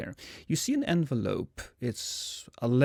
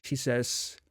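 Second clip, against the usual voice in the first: ordinary talk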